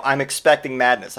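ordinary talk

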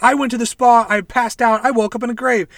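high, loud rushed account; frantic explanation. Retold or imitated. Paul explaining to his clone, high frantic account. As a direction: blurt out what happened. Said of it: "He's like".

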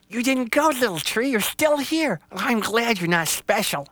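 very high, jittery little rabbit; a scared bunny saying farewell; timid rabbit voice. Acted. Rabbit says goodbye to Little Tree, very high and nervous.

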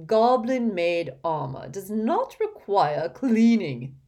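slow, loud, haughty lecturing; weighty, projected disdain; condescending pompous portrait. Acted. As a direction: talk down like an imperious headmaster.

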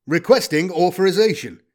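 war robot. Acted. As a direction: announce it like a combat machine. Robot asks leave to fire.

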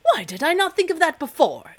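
high, loud excited exclamation; king bursts out in delighted surprise; exclaimed. A narrator's reading. King realizing the answer, high and loud exclamation. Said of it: "exclaimed the king".

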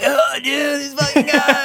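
high, slow, amused shout; laughing Steve-O. Retold or imitated. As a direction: crack up at the guy. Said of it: "Steve-O's just, like, laughing".

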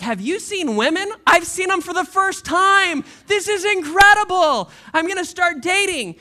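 put-on a kid discovering the world; giddy excitement. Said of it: "if I was him, I'd be like".

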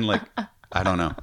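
ordinary talk, nothing marked

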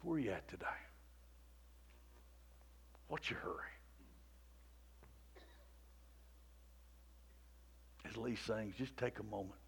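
very soft, low, hushed praying voice; quiet prayer. Acted. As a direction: pray gently over the congregation. Soft altar call and prayer.

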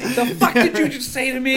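high, loud fake outrage; hammed-up tough-guy challenge; mock angry. Mock aggressive confrontation.

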